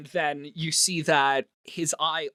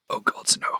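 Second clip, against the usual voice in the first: embarrassed whisper. Acted. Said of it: "turns red".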